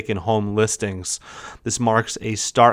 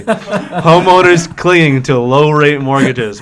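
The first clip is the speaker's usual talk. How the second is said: louder and slower, laughing; loud, slow repeat; 3 semitones higher, 7 dB louder, slower than usual. Shown as a demonstration. Repeating it for John who wasn't listening, loud and slow. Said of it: "John was not listening to me".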